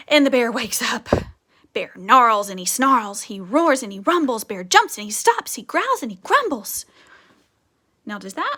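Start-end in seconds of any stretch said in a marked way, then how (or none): 0.1-6.8 put-on lively, louder read-aloud for kids; storybook reading. A narrator's reading. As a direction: read it like a bedtime book full of rhythm. Teacher reads picture book aloud.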